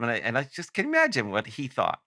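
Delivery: ordinary talk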